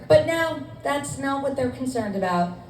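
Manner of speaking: normal talking voice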